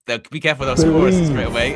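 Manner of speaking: imitating Flex. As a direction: fret it out loud. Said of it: "He went".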